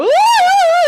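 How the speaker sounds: a sudden high, loud wailing sob; crying wail. Retold or imitated. Crying all the way home.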